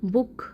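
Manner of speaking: बुक with a short oo; बुक as a short word for repeating. Shown as a demonstration. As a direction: say बुक alone, vowel brief before the k. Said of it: "short है".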